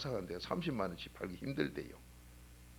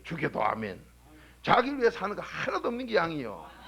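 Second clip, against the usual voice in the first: loud, driving sermon voice; fervent revival preacher, louder; preaching at full force; 4 semitones higher, 7 dB louder than usual. Acted. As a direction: preach it hard to the pews. Preacher exhorting the congregation.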